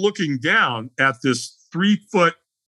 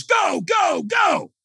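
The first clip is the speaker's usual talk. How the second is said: high-pitched cheering-on shout; urgent shout; much slower than usual. Retold or imitated. Trainer launching a firewalker onto the coals, urgent shout. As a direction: send them off with rising urgency.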